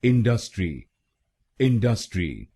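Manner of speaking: इंडस्ट्री with stress on डस; इंडस्ट्री stressing the middle syllable; इंडस्ट्री the common wrong way. Shown as a demonstration. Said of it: "गलत pronunciation में हम दूसरे शब्दांश पर".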